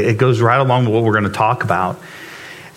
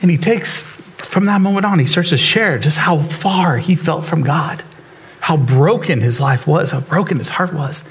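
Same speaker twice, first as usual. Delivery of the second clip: moved retelling; 4 semitones higher than usual. Real, not acted. Moved retelling of broken hymn writer.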